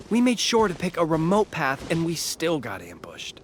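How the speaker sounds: usual speaking voice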